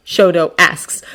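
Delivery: plain reading voice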